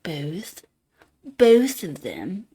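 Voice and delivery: "both" drawn out slowly, no L; pompous standard both. Shown as a demonstration. Trying most people's "both", pompously without L. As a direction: stretch "both" with a pure long o. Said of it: "pompous when I say both".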